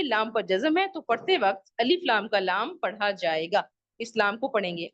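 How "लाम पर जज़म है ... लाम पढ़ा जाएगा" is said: ordinary talk, nothing marked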